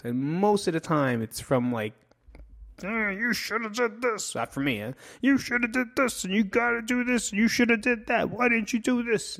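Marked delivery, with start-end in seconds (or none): 2.8-4.3 high-pitched scolding authority voice; nagging parent. Acted. Voicing the judgmental people above you, nagging parent. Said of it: "It sounds like your parents, your teachers". 5.2-9.4 high-pitched relentless scolding; nagging parent. Acted. Voicing parents and teachers who molded you, nagging parent. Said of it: "It sounds like your parents, your teachers".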